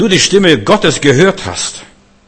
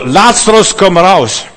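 far higher and louder, calling the dead out; commanding call; 6 semitones higher, 7 dB louder, slower than usual. Acted. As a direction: call Lazarus out of the tomb. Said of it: "Da ist es genauso".

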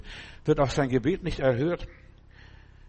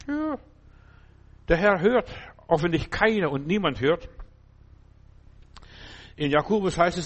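ordinary delivery

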